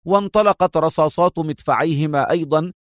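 ordinary delivery

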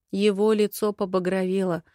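usual speaking voice

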